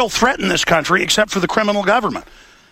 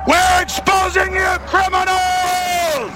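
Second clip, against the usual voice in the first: slow, high, furious protest shout; 12 semitones higher, much slower than usual. Real, not acted. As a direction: bellow it slowly at the building. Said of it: "I'm gonna salute these people".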